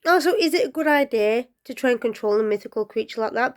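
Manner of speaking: usual speaking voice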